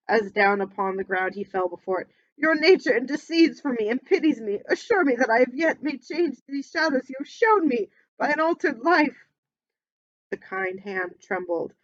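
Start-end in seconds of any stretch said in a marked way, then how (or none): 2.4-9.2 loud, high anguished no; desperate pleading. Acted. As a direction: shout your denial in terror. Scrooge begs at the grave.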